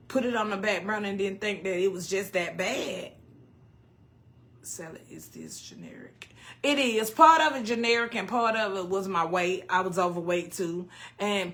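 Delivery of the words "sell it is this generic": very low, barely voiced mumble; murmured question reading. Real, not acted. Streamer reading a viewer's question, murmured low. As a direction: read it under your breath.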